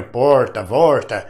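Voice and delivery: "Porta, Vorta" with a curled-back caipira R, L turned into R; "Porta" and "Vorta" said with the southern Minas country R. Shown as a demonstration. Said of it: "Esse R dobrado que você acha no sul de Minas".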